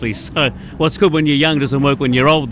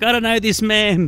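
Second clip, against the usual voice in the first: daughter's voice; 7 semitones higher than usual. Retold or imitated. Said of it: "My daughter's like".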